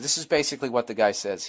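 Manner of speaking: ordinary delivery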